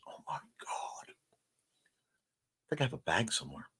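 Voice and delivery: breathy, slow whisper; slow secret whisper about snacks. Real, not acted. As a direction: hush it like a guilty secret. Craving snacks, hushed aside.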